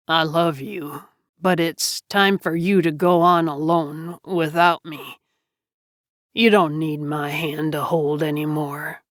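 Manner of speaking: low-key, lightly voiced last words; a hint of a weakened, peaceful man; peaceful dying man. A narrator's reading. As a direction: let them go calmly and gently. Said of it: "He smiled peacefully".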